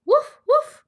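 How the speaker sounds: high, loud woofing; two sharp high barks, acted out; dog barks. Grandmother reading Biscuit book to children, dog barks.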